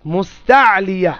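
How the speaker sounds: মুস্তালিয়া slow, stretching "taa" and "lii"; drawn-out pronunciation. Shown as a demonstration. Teacher answering how it is pronounced, slow মুস্তালিয়া. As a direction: sound out মুস্তালিয়া syllable by syllable. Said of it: "উচ্চারণ কি?".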